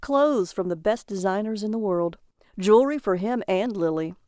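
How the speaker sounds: ordinary delivery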